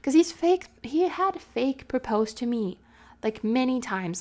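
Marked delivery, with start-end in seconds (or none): none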